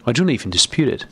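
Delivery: usual speaking voice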